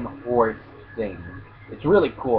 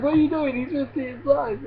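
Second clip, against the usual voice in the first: alarmed protest; 12 semitones higher, 4 dB quieter than usual. Retold or imitated. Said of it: "he's like".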